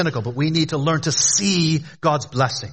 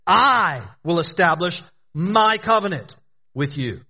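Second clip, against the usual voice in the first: drawn-out, emphatic "I" to open the quote; stress on I; 3 semitones higher, slower than usual. Acted. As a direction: land hard on "I", spacing out "will establish my covenant". Preacher stresses who makes the covenant.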